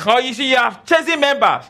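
high-pitched quote of detractors; mocking critics. Preacher quoting critics of his relationship seminar, high mocking imitation. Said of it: "they say".

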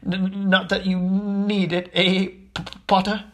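uneasy, halting professor; nervous stammer. A narrator's reading. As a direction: falter and stick on the first sounds. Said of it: "He laughed nervously".

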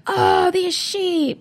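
high, drawn-out movie-style complaint; hammed-up tourist moaning about sheep, high and slow; mock exasperation. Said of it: "in all the movies, they're like".

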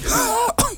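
cartoon laugh. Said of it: "O Goofy".

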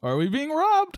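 high panicked squeal; mock panic. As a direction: squeak it out in fake alarm.

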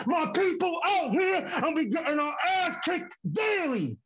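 anguished outcry. Acted. Poem climax about suffering.

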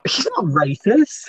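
high, drawn-out incredulous question; real disbelief, pitch jumping up; disbelieving. Real, not acted. Startled at news of racist actor.

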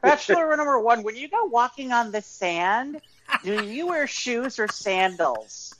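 high, slow game show host; flirty teasing dating host. Pretend. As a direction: tease the bachelor like a dating host. Mock dating game host question.

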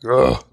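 mock theatrical guffaw; overdramatic laugh. Friend laughs off a warning at the guardrail, overdramatic mock laugh. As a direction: imitate his hammed-up string of laughs. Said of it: "all this overdramatic laugh".

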